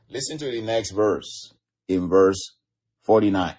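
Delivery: ordinary talk, nothing marked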